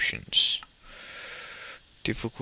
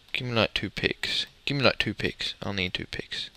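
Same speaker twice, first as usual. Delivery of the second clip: ordinary talk, nothing marked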